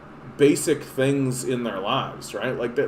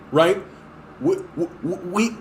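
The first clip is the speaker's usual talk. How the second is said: ordinary talk, nothing marked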